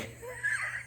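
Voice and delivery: breathy, voiceless burst of laughter; real, helpless little laugh; stifled laugh. Real, not acted. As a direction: crack up before the punchline. Cracks up before revealing the nickname.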